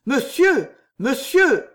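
cri haut et fort qui retombe; interromps, affolée, dans l'aigu; protestation alarmée. Acted. Juliette interrompt une prédiction effrayante. (supplication alarmée, voix haute et forte)

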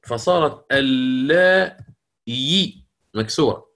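اللائي drawn out slowly with the final ya kasra; slow pronunciation demo. Shown as a demonstration. Teacher slowly shows students the changed word. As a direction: stretch out اللائي, the ya clearly with kasra. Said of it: "أبدلت ياء".

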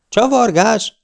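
high, rising outraged question; indignant protest. A narrator's reading. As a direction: protest in disbelief, voice shooting up. (felháborodott, magasba csúszó tiltakozás)

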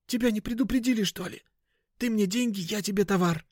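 high, hissy furtive voice; shady dealer, irritable. Acted. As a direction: lay down the deal in a hushed high hiss.

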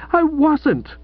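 a high, indignant cry of denial; Pauline cries out. A narrator's reading. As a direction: protest sharply, up high. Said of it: "cried Pauline".

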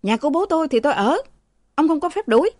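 high, fast defiant retort. Acted. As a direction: talk back defiantly, pitch high. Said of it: "Thằng Mão cãi".

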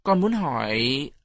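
put-on slow, drawn-out child's question; hesitant stammer. A narrator's reading. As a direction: falter shyly, pitch rising at the end. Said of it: "Tôi ấp úng". (giọng trẻ con ấp úng, ngập ngừng)

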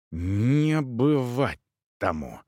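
slow, restrained anger through the teeth; tight-jawed refusal, drawn out; through clenched teeth. A narrator's reading. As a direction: force it slowly through gritted teeth. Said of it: "произнёс негромко, почти сквозь зубы".

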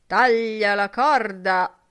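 loud, slow, heavy shout; thick-voiced shout. A narrator's reading. Mulligan shouting at Steven to clear off, slow and loud. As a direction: bark it out slowly and loud. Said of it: "gridò con voce spessa".

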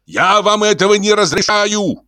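high, shouted prohibition; frantic official putting a foot down; outraged forbidding. A narrator's reading. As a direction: forbid it at a high-pitched yell.

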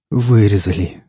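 ordinary delivery, nothing marked

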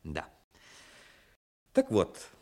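ordinary delivery

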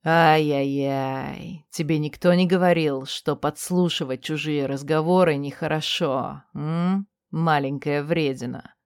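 low, slow, teasing male whisper; mocking whisper. A narrator's reading. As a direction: breathe it mockingly into her ear. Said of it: "насмешливо прошептал". (насмешливый низкий шёпот)

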